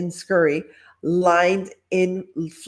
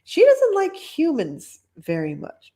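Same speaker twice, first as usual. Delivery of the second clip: put-on higher small-girl voice; little witch voice; 9 semitones higher than usual. Blanche warning the lady about her cat, light and high. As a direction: warn them sweetly. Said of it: "said Blanche".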